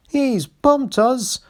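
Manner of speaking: high, slow angry screech; screaming coaches. A narrator's reading. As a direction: scream it like offended carriages. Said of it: "screamed the coaches".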